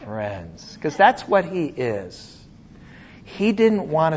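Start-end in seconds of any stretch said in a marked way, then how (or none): 0.0-0.8 softer and lower, gently; tender dear friends. Shown as a demonstration. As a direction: say dear friends like you mean it. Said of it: "notice he says".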